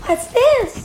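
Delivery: put-on high cute penguin girl, a bit calmer; lightly squeaky penguin girl. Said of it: "that's a cute little girl".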